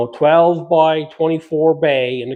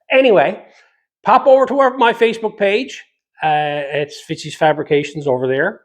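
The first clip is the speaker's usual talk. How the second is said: upbeat sign-off; 4 semitones higher than usual. Acted. Channel plug and sign-off.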